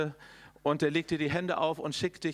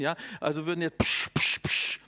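loud hissing psch psch of spraying water; spray the crowd with loud hisses; louder gushing psch sounds of a fountain; 5 dB louder than usual. Retold or imitated. Water pouring from belly.

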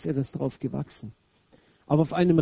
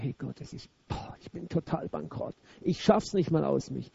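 a much softer, deflated confession; leises Geständnis; 2 semitones lower, 7 dB quieter than usual. Acted. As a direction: admit it quietly, worn down. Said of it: "dass er sagt". (leises, erschöpftes Bekenntnis an Gott)

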